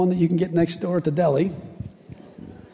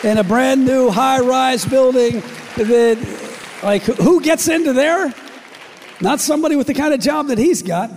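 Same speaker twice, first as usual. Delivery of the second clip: rising emphasis; 6 semitones higher, 4 dB louder than usual. Acted. Preacher building to climax.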